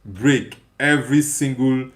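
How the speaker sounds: usual speaking voice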